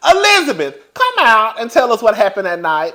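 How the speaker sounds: mock summons, name called loud and high; calling out. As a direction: call her out by name, then ease down. Calls on absent woman to speak.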